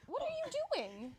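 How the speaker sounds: high-pitched, baffled question; startled question. Real, not acted. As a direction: ask it high in real bewilderment. Host reacts to falling mic.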